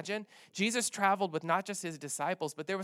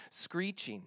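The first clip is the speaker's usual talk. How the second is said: ordinary delivery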